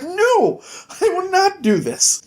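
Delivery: high, prim, shocked lady voice; scandalised lady. Retold or imitated. Dying lady aghast at Jack's proposal. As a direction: clutch your pearls, aghast.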